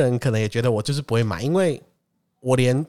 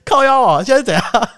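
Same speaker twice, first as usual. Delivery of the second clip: high, louder exasperation; mild mock annoyance; mock outrage; 11 semitones higher, 6 dB louder than usual. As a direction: blurt it out, fed up. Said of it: "我心里就想说".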